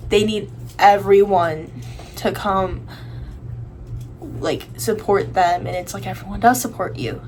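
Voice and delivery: ordinary talk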